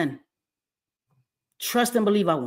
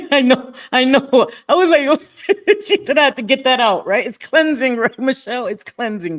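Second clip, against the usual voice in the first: laughing; 5 semitones higher, 7 dB louder than usual. Real, not acted. Laughing off her outburst.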